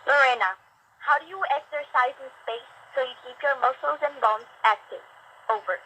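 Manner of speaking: distant radio voice. Real, not acted. Student question over the radio link.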